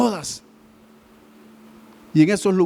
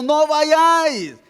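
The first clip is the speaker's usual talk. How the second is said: slow, higher, "vayáis" drawn out and stressed; emphatic warning; 6 semitones higher, much slower than usual. Real, not acted. Preacher warning the church, slow emphatic command. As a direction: plant each word like a command. Preacher warns against false christs.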